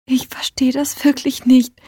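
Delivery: whispered, close to tears; sniffling whisper. A narrator's reading. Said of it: "schniefte Heidi".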